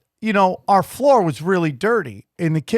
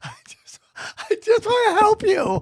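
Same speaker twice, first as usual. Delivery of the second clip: high, crying-edged plea, quick finish; pleading agent; 6 semitones higher than usual. Retold or imitated. Mock agent begging the angry wife, high and tearful. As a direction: beg to help, voice breaking in jest.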